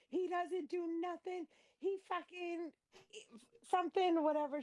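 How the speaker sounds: high whiny mock woman's voice; mocking her. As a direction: squeal her complaints up high. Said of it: "she was like".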